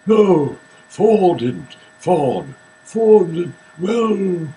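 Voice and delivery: lightly put-on British classical actor; Gielgud impression. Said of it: "Do it like John Gielgud".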